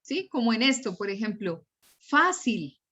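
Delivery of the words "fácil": "fácil" stressed on FÁ, falling away; stress on first syllable. Shown as a demonstration. Teacher gives students an example word, "fácil" stressed on FÁ. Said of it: "Yo no digo fácil, digo fácil".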